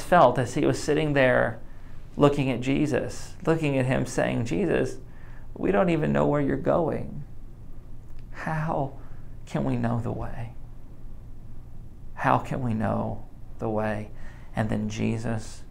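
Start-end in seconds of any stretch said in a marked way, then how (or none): 4.5-14.1 soft pleading. Acted. Thomas pleading with Jesus, soft.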